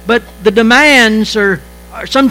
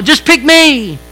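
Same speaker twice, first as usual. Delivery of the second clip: put-on eager kid volunteering; 5 semitones higher, slower than usual. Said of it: "I was one of those kids".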